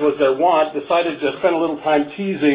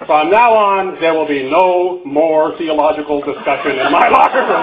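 loud coach's decree, hammed up; mock furious coach; 4 dB louder than usual. Coach banning religious debate after hearing the poem, mock fury. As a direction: lay down the law like an exasperated coach, building to the end. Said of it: "The coach is furious".

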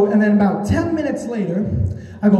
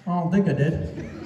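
softer, lower, laughing through a guilty denial; sheepish denial; 4 semitones lower, 6 dB quieter than usual. Retold or imitated. Caught by the cop, quietly fibbing with a laugh. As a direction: mumble it low like you know you're caught.

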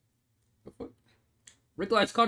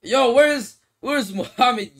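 loud excited call across the room; shouting for a friend on arrival; 7 semitones higher, 10 dB louder, much slower than usual. Retold or imitated. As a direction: call out for him, loud and high. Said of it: "I deadass went".

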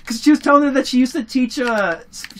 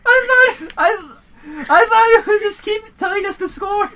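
laughing; 10 semitones higher, 3 dB louder than usual. Real, not acted. Embarrassed admission while laughing.

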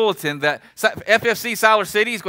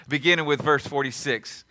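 ordinary talk, nothing marked

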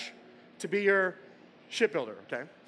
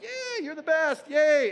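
crew cheering; 8 semitones higher, 4 dB louder, slower than usual. Acted.

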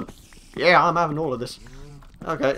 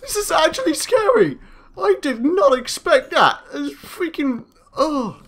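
shaken and scared; 8 semitones higher than usual. Real, not acted. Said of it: "I was actually scared".

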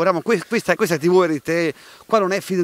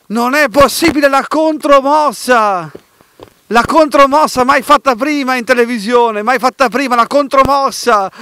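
excited announcer; 3 semitones higher, 6 dB louder than usual. Acted. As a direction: sell it like a TV host. Host hyping the move.

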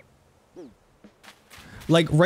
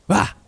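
startling yell; 6 semitones higher than usual. Pretend. Pretending to jump scare viewers.

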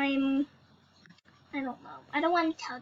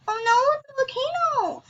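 high, quick cry of alarm; playful alarm; 7 semitones higher, faster than usual. Pretend. Player spotting a volcano in the game, alarmed. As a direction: yelp the warning in a rush.

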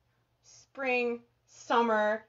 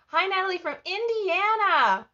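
excited greeting; 7 semitones higher, 7 dB louder than usual. Real, not acted. Host greets a viewer from Indiana, excited. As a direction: call out a big welcome.

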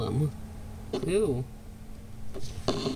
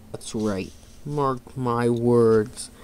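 slow menacing villain, rising to loud; villain vow; 4 dB louder, slower than usual. Acted. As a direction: threaten revenge, building to a roar. Villain vows revenge.

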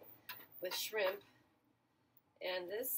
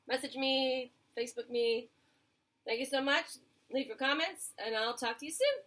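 louder, bright sign-off to viewers; upbeat outro; 3 semitones higher, 6 dB louder than usual. Acted. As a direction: call out the goodbye like a host.

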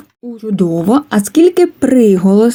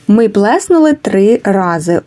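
ordinary talk, nothing marked

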